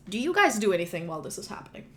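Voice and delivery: ordinary talk, nothing marked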